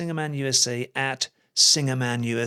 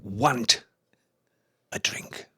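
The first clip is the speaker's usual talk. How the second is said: grave quoted warning; 4 semitones lower than usual. Retold or imitated. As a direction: let it sink toward a near-whisper, word by word. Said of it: "And the way he said it".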